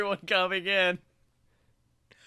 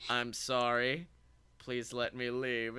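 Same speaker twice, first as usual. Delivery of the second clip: low, soft, retold meek apology; sheepishly sad; 7 semitones lower, 9 dB quieter than usual. Employee asking security to let them go, sadly sheepish. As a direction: plead quietly, like someone caught and ashamed. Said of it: "I had to sadly show them".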